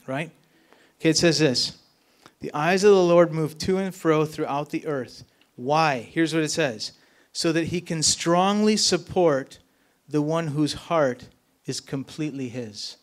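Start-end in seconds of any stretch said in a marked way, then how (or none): 2.4-5.2 loud, emphatic reading of the verse; louder scripture reading. Acted. Pastor proclaiming the verse to the congregation, loud and emphatic. 7.3-13.0 slow, emphatic proclaiming of the verse; slow scripture reading. Acted. Pastor reading the key verse to the congregation, slow and emphatic.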